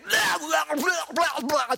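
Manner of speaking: a high shouted cartoon voice brushing off the question; cartoon character voice. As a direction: yell it like a silly cartoon guy. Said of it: "the guy from the Warner Brothers cartoon".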